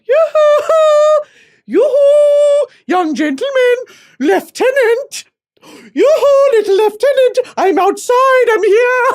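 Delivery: very high, loud sing-song calls; flirty yoo-hoo calls. Pretend. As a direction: holler like a showgirl waving a hanky. Calling to a discharged soldier.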